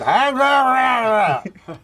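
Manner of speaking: loud, high garbled slur; Larry Flint. Retold or imitated. Larry Flint answers a greeting, garbled and loud. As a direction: yell it as mangled, barely intelligible syllables. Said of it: "Hi, Larry".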